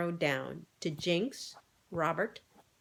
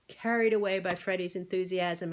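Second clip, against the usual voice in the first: ordinary delivery, nothing marked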